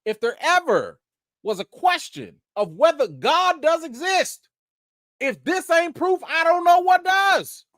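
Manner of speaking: rising to a shout like a preacher; preacher excitement. Real, not acted. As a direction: testify it like Sunday church. Preaching-style celebration of a miracle.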